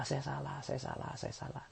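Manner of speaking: 'saya salah' dropping into a whisper; fading to a whisper. Shown as a demonstration. Preacher showing what to say at every quarrel, whispering. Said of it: "Setiap ada apa".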